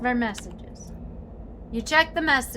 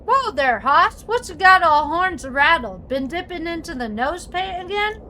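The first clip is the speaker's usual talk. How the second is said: cowboy voice; 6 semitones higher, 6 dB louder than usual. Acted. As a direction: talk like an old prospector. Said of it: "Gabby doesn't talk like that".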